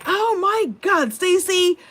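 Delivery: high, loud exasperated cry at a relative; exasperated phone shout. Retold or imitated. Shopper on the phone with family, high exasperated shout. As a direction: mimic an annoyed shopper calling out a name. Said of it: "she was not happy".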